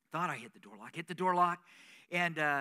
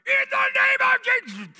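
much higher, loud shouted rebuke; pretend panicked shout; 14 dB louder than usual. Pastor imagining scaring off the woman in his car, shouting. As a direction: yell it like you just got the fright of your life. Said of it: "What would have happened if I".

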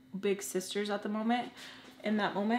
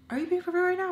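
disbelief; 10 semitones higher, 3 dB louder, much faster than usual. Retold or imitated. Friends shocked, retold.

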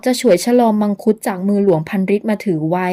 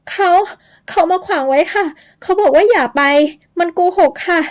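put-on much higher, scared girl's voice; frightened girl; 10 semitones higher than usual. Young woman tells Luang the ghost blocks them, frightened and high. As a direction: plead with Luang in fear, high.

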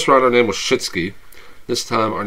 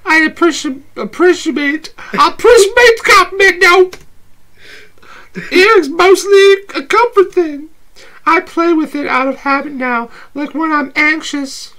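Abby, teasing, pitched way up; playful date voice, high and bright; high girly voice; 10 dB louder than usual. Acted. S0 holds Abby voice.

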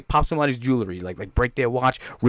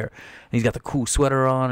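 usual speaking voice

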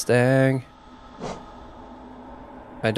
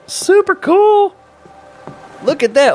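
high, slow, thrilled delight; excited; slower than usual. Real, not acted. Delighted at his journal.

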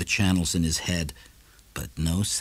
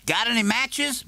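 much higher neighbour voice; Morrison's voice; 14 semitones higher than usual. A narrator's reading. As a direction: ask the shopkeeper for matches casually.

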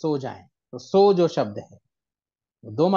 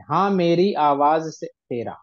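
slow, measured recitation; slow recited line; 4 dB louder, slower than usual. Shown as a demonstration. Teacher recites the ghazal line slowly to show timing. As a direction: let every syllable take its time. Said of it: "time कितना लग रहा है बोलने में".

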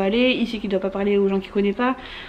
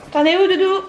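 raised, high call across the house; 9 semitones higher, 5 dB louder than usual. Real, not acted. Calling out to child.

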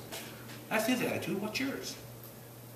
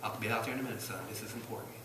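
soft aside; 7 semitones lower, 10 dB quieter, faster than usual. Retold or imitated. Parent shooing child away.

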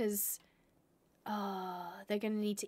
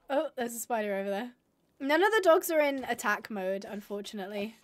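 ordinary delivery

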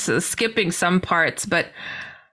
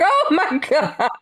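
laughing disbelief; 8 semitones higher than usual. Retold or imitated. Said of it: "I'm like".

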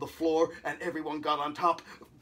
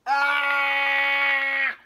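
long, loud, high scream; sustained full-volume wail; yelled; 9 semitones higher, 10 dB louder than usual. A narrator's reading. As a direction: holler from trapped inside a book. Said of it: "yelled the writer from inside".

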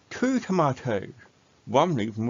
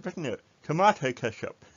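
ordinary delivery

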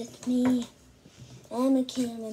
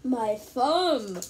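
usual speaking voice

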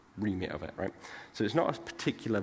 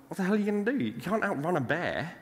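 lightly acted, higher-pitched disbelief; mock incredulous; 8 semitones higher than usual. One walker to his friend fleeing a bear, mock incredulous. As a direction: question your friend's plan, voice pitched up.

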